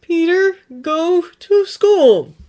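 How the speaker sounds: high, slow groaning plea; exasperated groan at the kid. A narrator's reading. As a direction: drag it out like a weary dad. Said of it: "he groans out".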